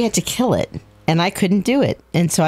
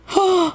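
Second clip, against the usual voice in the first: startled gasp; 13 semitones higher than usual. Real, not acted. Scared by ghost in game.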